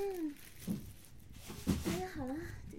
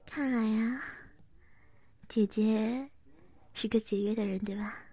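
ASMR whisper; 2 semitones lower than usual. Acted. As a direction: murmur close and teasing. (贴耳轻声细语)

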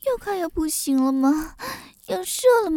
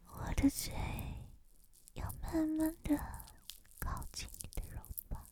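close whisper; 18 dB quieter, faster than usual. Acted.

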